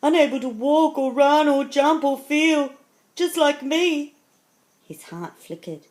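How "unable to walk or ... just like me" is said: high, very loud, mounting urgency; anguished lion. A narrator's reading. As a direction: cry out the lion's distress for the children. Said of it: "he thought".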